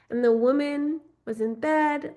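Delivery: ordinary delivery, nothing marked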